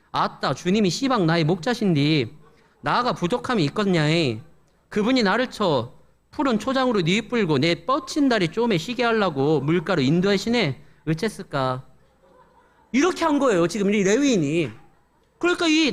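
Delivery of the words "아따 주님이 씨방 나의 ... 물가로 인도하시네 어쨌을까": Jeolla dialect recitation; 전라도 사투리. Acted. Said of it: "전라도 버전으로 한 거예요". (구수한 전라도 사투리로)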